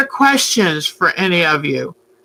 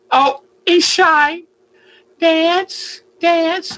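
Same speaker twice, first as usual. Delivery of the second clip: high, slow pet talk; sweet high voice to a shy bird; coaxing the bird; 8 semitones higher, much slower than usual. Acted.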